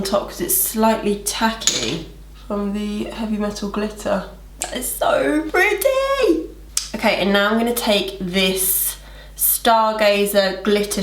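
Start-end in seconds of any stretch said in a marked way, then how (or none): 4.6-6.5 high, drawn-out gushing; excited squeal. Real, not acted. Vlogger admiring her glitter, gushing in a high squeal. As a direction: coo it high and slow, delighted.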